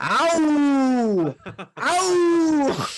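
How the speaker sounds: hammed-up high yelps; mock pained cries. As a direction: yelp like skin is burning. Mimicking a man burning.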